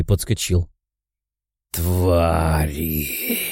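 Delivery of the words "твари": low demon growl; furious growl. A narrator's reading. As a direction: growl it in fury. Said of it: "с яростью прорычал демон".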